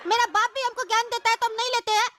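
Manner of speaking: high-pitched sassy street hawker voice; cheeky kite seller. Acted. Annoyed kite seller tells off the passenger, in a high cheeky voice.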